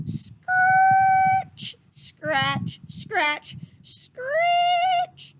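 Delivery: scratchy sound-effect voice for the hoe; hoe scratching noise. Acted. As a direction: mimic a hoe scraping the ground, spiking high and loud. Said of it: "he heard the noise of a hoe".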